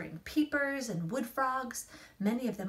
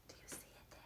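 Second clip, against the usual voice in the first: softer, hushed question; whisper; 24 dB quieter, much faster than usual. Acted. Mom whispering to her child about a salamander. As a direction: whisper close, pointing it out.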